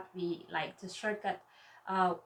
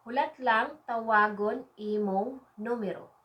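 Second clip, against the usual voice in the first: word by word for learners; measured phrase model; 3 dB louder than usual. Shown as a demonstration. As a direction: space out each word carefully. Teacher models phrase, then repeats faster.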